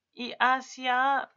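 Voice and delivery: hacia said slowly and loud, the first syllable leaned on; slow, loud demo of hacia; stressing the word. Shown as a demonstration. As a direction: draw out hacia with a heavy first beat. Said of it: "que es como remitiéndonos hacia un lugar".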